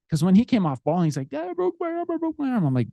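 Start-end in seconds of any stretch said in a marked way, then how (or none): 1.3-2.7 very high-pitched mock kid's cry; squealed childlike complaint, pitched way up; imitated wailing child. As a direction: imitate a hurt little boy wailing. Imitates hurt child coming off field.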